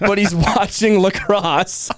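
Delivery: laughing. Real, not acted. Punchline of funny ad.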